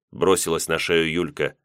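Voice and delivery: usual speaking voice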